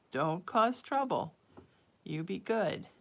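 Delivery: normal talking voice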